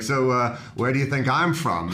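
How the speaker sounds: usual speaking voice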